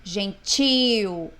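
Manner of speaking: Gentil with TIL loud and drawn out; stress on TIL. Shown as a demonstration. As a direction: push hard on the last syllable of Gentil. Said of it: "O tio aqui ficou mais forte". (Gentil com o til bem forte e longo)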